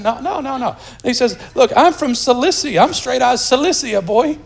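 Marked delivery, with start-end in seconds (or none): 0.0-0.7 high, quick protest; acted denial; mock indignant denial. Paul denying in acted dialogue. 1.5-4.4 high swaggering street tough; Paul voiced as a tough from the block, mock gangster. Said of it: "he doesn't go gangster".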